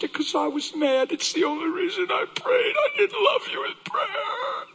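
re-enacted confession climbing to a high wail; emotional confession. Wife confessing to her husband, increasingly distraught. Wife's confession builds to crying peak.

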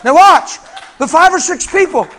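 loud, high fervent preaching; full-force preaching. Acted. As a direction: preach it out with all your fire.

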